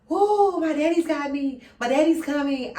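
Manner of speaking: high, loud thrilled gush about daddy coming; giddy little girl thrilled her dad is coming; excited whoa. Retold or imitated. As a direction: squeal with delight that daddy's on the way.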